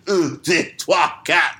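lightly voiced kids' TV teacher counting in French; mock French counting. Recalling French lessons on Canadian Sesame Street, lightly mocking. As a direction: count off un, deux, trois like a Canadian children's show. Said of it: "He'd be like".

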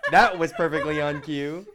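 loud laugh through the words; cracking up mid-sentence. Real, not acted. Laughing at cohost's cough.

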